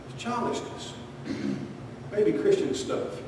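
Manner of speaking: soft whispered reproach; dismissive whisper. Acted. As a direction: hush it like a put-down. Scolding aside to congregation.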